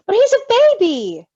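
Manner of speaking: high, loud protest. A narrator's reading. Reader voicing Patton protesting loudly. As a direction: plead that he's only a baby. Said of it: "Patton protested".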